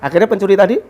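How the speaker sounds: ordinary talk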